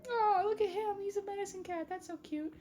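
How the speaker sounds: high, softening adoring coo. Acted. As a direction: gush over something cute in a high little voice. Cooing over the medicine cat.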